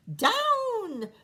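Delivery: high, loud cry of "Down!"; cried out. A narrator's reading. Witch calling her cat to land, crying out. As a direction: call it out sharply to the sky. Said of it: "cried the witch".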